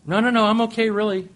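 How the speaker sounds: high protesting "no, no, no"; quoting wife. Retold or imitated. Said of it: "wife answers husband".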